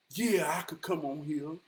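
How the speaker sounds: usual speaking voice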